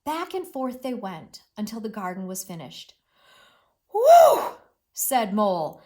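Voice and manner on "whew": tired whew. Acted. Mole after hard garden work.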